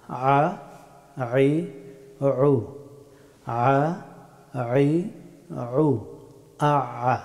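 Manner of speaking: "আ, আই, উ" squeezed from mid-throat; Arabic ayn. Shown as a demonstration. Teacher drilling the difficult ayn, mid-throat sound. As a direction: constrict the throat on each vowel of "আ, আই, উ". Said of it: "গলার মধ্যখান থেকে".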